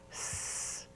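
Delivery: "s" as a clean, soft hiss; correct S sound. Shown as a demonstration. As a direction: say "s" as a sustained hiss through the middle. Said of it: "most kids make S like this".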